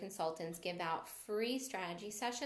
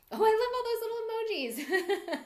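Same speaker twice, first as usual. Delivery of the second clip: high, loud delighted laugh; real laugh of delight; 14 semitones higher, 9 dB louder than usual. Real, not acted. Reacting to viewer emojis.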